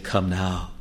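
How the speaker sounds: quiet, gentle call to come; soft invitation. Acted. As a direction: offer it softly, almost under the breath. God's invitation quoted softly.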